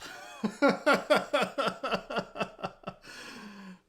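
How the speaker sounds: high, loud cackling laughter; real uncontrolled laughter; long laughing fit. Real, not acted. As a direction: crack up helplessly at your own bit. Laughs after own impression.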